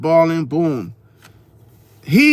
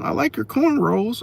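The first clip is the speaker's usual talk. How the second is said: mother; 7 semitones higher, 4 dB quieter than usual. Retold or imitated. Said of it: "her mother was like".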